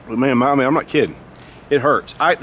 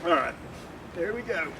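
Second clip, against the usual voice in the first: choking on tear gas; 9 semitones higher, 7 dB quieter than usual. Real, not acted. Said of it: "Starting to run now".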